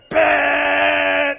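loud mock horn, like a game-show buzzer; car horn blast. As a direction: blast it like an impatient driver's horn. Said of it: "like a Family Feud buzzer".

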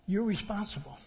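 ordinary delivery

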